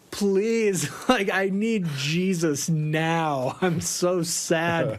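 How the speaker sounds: exaggerated self-pitying moan; mock whiny plea.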